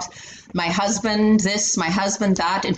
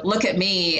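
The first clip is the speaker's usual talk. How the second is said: usual speaking voice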